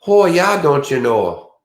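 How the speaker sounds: high, punchy North Dakota "oh yeah"; North Dakota accent. Acted. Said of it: "of course, the North Cl-, Dakota, like".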